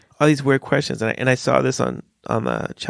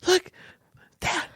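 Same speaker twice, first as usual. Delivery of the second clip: put-on child whispering then exclaiming; slower than usual. Father imitating his son whispering about a lady's stomach, then blurting. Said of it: "he's like".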